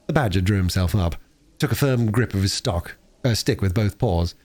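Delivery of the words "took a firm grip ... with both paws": ordinary delivery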